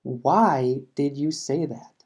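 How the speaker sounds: slow, even model sentence; slow repeat. Shown as a demonstration. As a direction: say it slowly again so learners follow. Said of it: "Por ejemplo".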